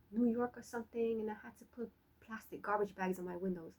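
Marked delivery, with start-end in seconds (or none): none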